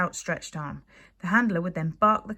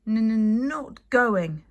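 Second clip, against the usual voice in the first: stubborn stammer; 5 semitones higher, 7 dB louder, much slower than usual. Acted. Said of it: "said Toothless stubbornly".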